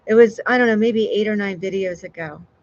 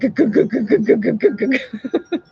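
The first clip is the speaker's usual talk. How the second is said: rapid high "guh-guh-guh" pounding noise; imitated racing heartbeat; 4 semitones higher, 4 dB louder than usual. Streamer showing their heart's reaction to a gift, racing heartbeat noise. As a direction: mimic a thumping heart, ending in laughter. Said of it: "my heart is like".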